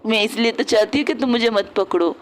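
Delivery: normal talking voice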